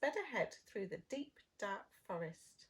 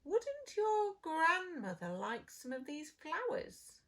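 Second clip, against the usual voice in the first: sly wolf; 7 semitones higher, 4 dB louder than usual. Acted. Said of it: "he smiled".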